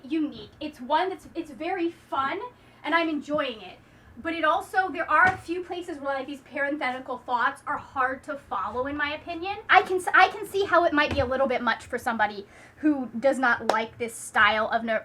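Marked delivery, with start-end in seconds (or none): none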